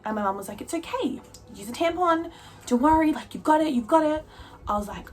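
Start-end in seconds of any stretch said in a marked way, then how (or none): 0.6-4.3 high-pitched pep talk, imitating mum; encouraging mum. Mum urges daughter to use a tampon, encouraging. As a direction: cheer on a nervous daughter, pitched well up.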